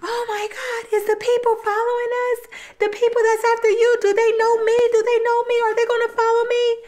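very high, fast fake-frightened squeal; acting scared. [name] in the car faking panic to get let out, very high squeaky fear. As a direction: play up the panic to fool him. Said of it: "get on her acting skills".

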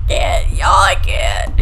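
overwhelmed by fright; scared laughing shout. Real, not acted. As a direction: laugh in fear and yell.

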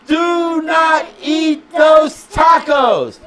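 slow, high, loud shouted warning; dangerous warning. Shown as a demonstration. Parent showing how danger sounds, shouted warning. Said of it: "I want you to sound like it's dangerous".

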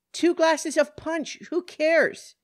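loud, high-pitched angry retort; exasperated retort. Acted. Woman questioned on drinking, exasperated retort. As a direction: throw the answer back in frustration.

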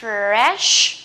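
Fresh drawn out, ending in a long sh; pouted SH. Shown as a demonstration. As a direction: say "Fresh" slowly, lips pushed out on sh. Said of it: "S H mình chưa mỏ nè". (Fresh kéo dài, chu mỏ âm sh)

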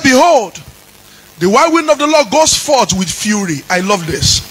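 shouted, sung preaching over the music; full-force preaching. Acted. Pastor calls down the God of vengeance in a fiery sung sermon. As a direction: preach it with fire and fury.

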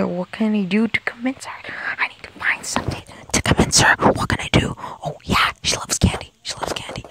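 secret whispered scheming; hushed plotting under the breath. Real, not acted. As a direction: whisper the plan to yourself. Plotting to himself how to convince.

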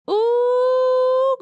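a sudden high, loud squeal; excited ooh. Real, not acted. Fired up about the landlord, a high loud ooh. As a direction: let it burst out high and loud.

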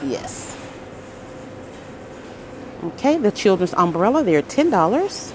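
ordinary delivery

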